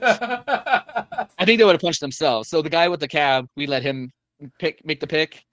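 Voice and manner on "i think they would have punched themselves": slow, high laugh through the words. Real, not acted. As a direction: laugh it out loud. Laughing at the friend's joke.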